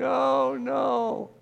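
high, drawn-out mock wail; imitated crying. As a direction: wail it in despair, not anger. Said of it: "He's like crying".

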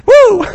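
high, loud excited whoop; sudden joyful whoop. Real, not acted. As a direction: let out a big woo. Preacher exclaims at scene.

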